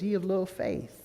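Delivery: soft, understated chiding; quiet rebuke. Retold or imitated. Jesus pulls Peter up, quietly chiding. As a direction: chide him gently under your breath.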